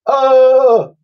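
very high, loud wail of fear; falling man's howl; cry. Acted. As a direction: scream as he plunges. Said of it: "cried Mister Wrath".

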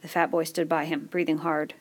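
ordinary delivery

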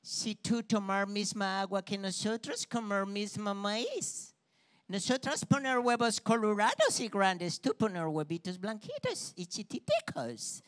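put-on slow broken Spanish with an American accent; American hen mocking the Cuban hen, in an American accent; gringa hen accent. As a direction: talk down like a snooty gringa hen. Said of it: "le dice una de esas gallinas gringas".

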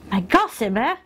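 Gossamer in an odd put-on accent. Real, not acted. Beautician naming her next lash product, odd accent. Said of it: "I don't know why I said it like that".

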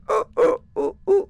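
high, muffled ghostly groan; like a voice through a wall. Retold or imitated. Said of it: "that was a muffled voice".

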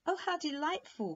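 high, bright delighted exclamation; delighted cry. A narrator's reading. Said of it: "cried Princess Venetia Ella".

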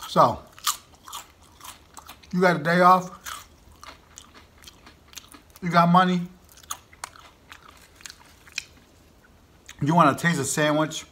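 small bites between words; talking while chewing. Real, not acted. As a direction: mouth partly full of pepper. Seen 1: Puts orange pepper pieces into mouth at 0.2 and 5.6 while talking.

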